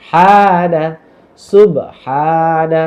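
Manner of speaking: সুব with a light bounce on b, হানা with a long first vowel; correct recitation demo. Shown as a demonstration. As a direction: recite সুব হানা slowly, stretching the "haa" in হানা. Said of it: "শব্দগুলো শুদ্ধ করে পড়ে যাচ্ছি". (সুব হানা টেনে শুদ্ধ তিলাওয়াত)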